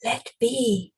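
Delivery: gentler creature voice. Acted. Spider creature's gentler side pleads in a hush. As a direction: coax it gently, hushed. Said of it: "repeated the gentler voice".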